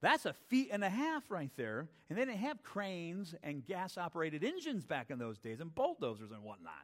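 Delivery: ordinary talk, nothing marked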